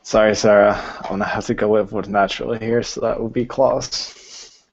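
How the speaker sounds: ordinary talk, nothing marked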